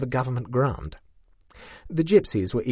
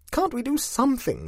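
tearful. A narrator's reading. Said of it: "she persisted tearfully".

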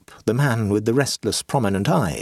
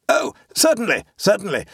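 hasty; 10 semitones higher than usual. A narrator's reading. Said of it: "said Amblecope hastily".

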